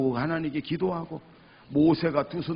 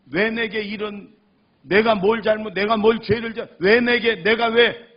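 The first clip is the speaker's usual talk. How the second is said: loud, high cry of injustice; indignant protest; 7 semitones higher, 8 dB louder than usual. Retold or imitated. Man wronged before God, indignant protest. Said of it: "막 억울해서 분해서 하나님 앞에".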